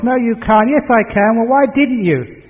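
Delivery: high-pitched argumentative back-and-forth; exasperated contradicting; bickering. Acted. Acted back-and-forth quarrel.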